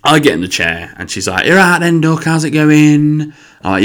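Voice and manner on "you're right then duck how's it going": high, loud, drawn-out Nottingham greeting; Nottingham hairdresser. Retold or imitated. Hairdresser greeting a customer, hammed-up Nottingham accent. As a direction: put on a chatty Nottingham mum. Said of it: "glitz and glam mummy from Nottingham".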